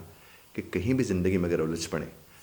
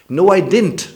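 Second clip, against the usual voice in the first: much higher, loud No I didn't; heated denial; 12 semitones higher, 13 dB louder, much slower than usual. Shown as a demonstration. Accused man shouts back his denial. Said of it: "और अब आगे से कहते".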